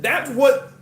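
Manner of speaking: normal talking voice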